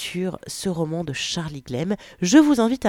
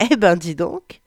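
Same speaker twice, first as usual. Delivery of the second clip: louder teasing exclamation; joking; 5 dB louder than usual. Acted. Charlotte joking to Lu after her climax, teasing. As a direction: tease her lover playfully. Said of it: "plaisante-t-elle".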